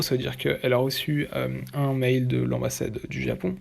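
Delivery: ordinary delivery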